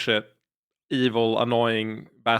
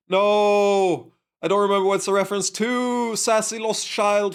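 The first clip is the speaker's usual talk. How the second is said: excited exclaim; 8 semitones higher, 3 dB louder than usual. Real, not acted. Can't recall the reference.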